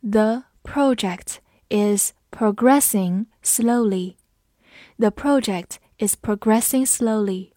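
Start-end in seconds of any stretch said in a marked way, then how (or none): 0.0-4.1 slow, word by word with gaps; slow reading. Shown as a demonstration. Teacher reads the example slowly for students, slow reading. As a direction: read it slowly, every word separate. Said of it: "我们慢读一遍".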